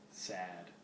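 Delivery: usual speaking voice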